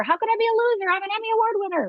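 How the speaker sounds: how dare anyone call me a loser; mock indignant boast. Voicing her own loser fear.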